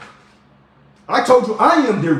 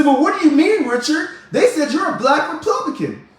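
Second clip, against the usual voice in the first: much higher-pitched questioning voice; challenging viewer; 8 semitones higher than usual. Acted. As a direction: put on a higher voice for the doubter.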